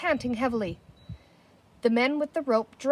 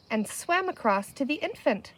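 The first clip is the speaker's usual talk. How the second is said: usual speaking voice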